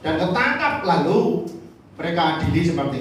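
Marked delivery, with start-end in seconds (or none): none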